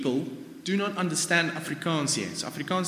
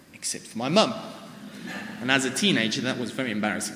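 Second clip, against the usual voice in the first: ordinary talk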